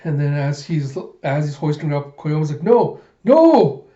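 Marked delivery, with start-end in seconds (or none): 2.6-3.9 high, loud wail; panicked protest. Retold or imitated. Koyomi is hoisted up by Den, high panicked cry.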